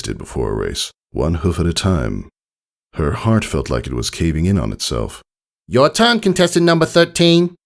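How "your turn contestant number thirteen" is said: high nasal official calling out; nasally pony. Acted. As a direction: announce the next contestant through the nose. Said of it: "the nasally pony said".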